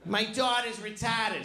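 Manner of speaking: Boston teacher lecturing the class. Retold or imitated. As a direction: imitate a fifth-grade Boston sex-ed teacher. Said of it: "She used to tell us every day".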